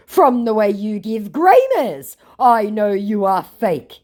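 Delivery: slow, high, loud accusing voice; mocked scammer. Scammer gaslighting the baiter, mocking voice. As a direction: drag out his muddled accusation. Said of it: "he gaslights".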